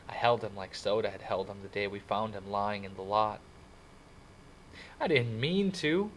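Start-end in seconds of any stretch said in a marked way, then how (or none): 5.0-6.1 higher, drawn-out anguished plea; blurted out. A narrator's reading. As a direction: blurt it out in distress. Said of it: "he finally blurted out".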